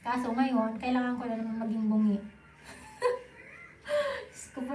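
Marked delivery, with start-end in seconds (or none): 3.0-4.7 high laughing cry of shame; half-laughing, half-whining; embarrassed laugh. Real, not acted. (nahihiyang tawa)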